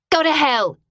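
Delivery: high, loud furious outburst; spat curse. A narrator's reading. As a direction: spit the words at him. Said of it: "she spat at him".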